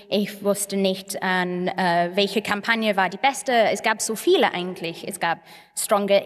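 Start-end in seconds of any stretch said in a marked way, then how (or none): none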